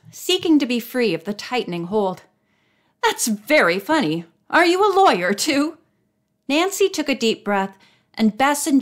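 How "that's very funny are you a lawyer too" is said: sarcastic, rising jeer; mocking retort. A narrator's reading. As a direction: taunt her with the question. Angry man mocks the girl.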